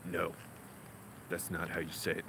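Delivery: very low, hushed tutor with cold menace; hostile tutor impression. Tutor telling his student he got it wrong, low and hostile. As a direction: imitate a disliking tutor, low and soft. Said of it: "Why do you sound like you don't like me?".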